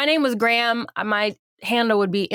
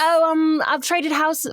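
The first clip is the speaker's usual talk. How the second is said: higher-pitched mock English accent; English accent by mistake, pitched up; mistaken English accent; 5 semitones higher, slower than usual. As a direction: slip into a put-on British accent. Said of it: "sorry, she doesn't have an English accent".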